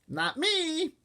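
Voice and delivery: put-on toddler denial. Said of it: "Fudge said".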